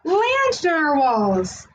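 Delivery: amazed exclaim. Acted. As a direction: stretch it out in awe. Kelp sees unicorns.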